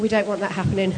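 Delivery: ordinary talk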